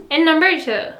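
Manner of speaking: normal talking voice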